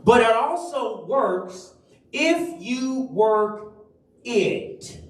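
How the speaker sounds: if weighted in a slowed phrase; slow stress on IF. Shown as a demonstration. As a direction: lean on if slowly. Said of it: "by emphasizing a different word".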